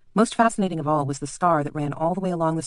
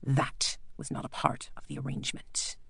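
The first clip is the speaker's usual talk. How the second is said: quiet menacing doctor; 2 semitones lower, 9 dB quieter than usual. Acted. Bleak quietly reminds the lord of their deal.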